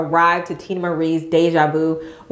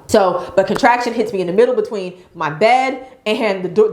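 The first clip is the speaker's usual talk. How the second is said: normal talking voice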